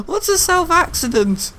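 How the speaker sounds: very high, slow, giggling question; squeaked out through laughter; laughing incredulity. Real, not acted. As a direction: ask it helplessly amused at your blunder. Laughing at own slip.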